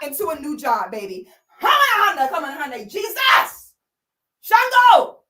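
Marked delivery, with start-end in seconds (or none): 1.6-5.2 loud, high, fevered cries; ecstatic praying. Pretend. Girlfriend shouting car brands in prayer, loud and high. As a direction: cry out in holy frenzy.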